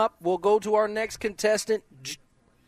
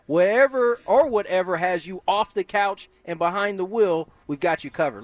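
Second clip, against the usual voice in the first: ordinary talk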